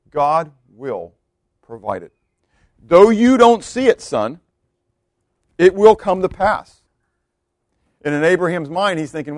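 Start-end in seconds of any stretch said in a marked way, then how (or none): 2.8-6.8 slow, high, loud declaration; firm, deliberate promise; emphatic assurance. Acted. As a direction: proclaim it slowly to a son. Voices Abraham assuring his son.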